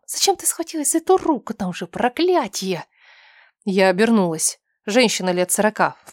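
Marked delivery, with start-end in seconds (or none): 0.1-2.8 high, pitying woman's voice; kindhearted woman's whisper. A narrator's reading. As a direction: scold her anxiously out of pity. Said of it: "шепнул сердобольный женский голос рядом".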